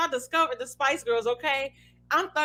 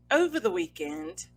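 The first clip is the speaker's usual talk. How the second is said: put-on British accent; 3 semitones lower, 3 dB quieter than usual. Podcaster starting a news report for co-host, British accent. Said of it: "gonna do my British accent".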